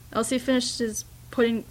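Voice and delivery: plain reading voice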